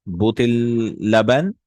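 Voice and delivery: butil laban said slowly and deliberately; Arabic model phrase. Shown as a demonstration. Teacher modelling a bottle of milk in Arabic. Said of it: "let's try it again".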